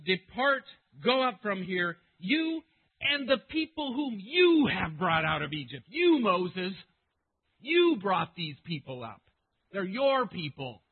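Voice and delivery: loud, with "you" punched hard; forceful pronouncement. Acted. Preacher as the Lord addressing Moses, loud and emphatic. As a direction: declaim it, putting the responsibility on "you". Preacher voices God handing over people.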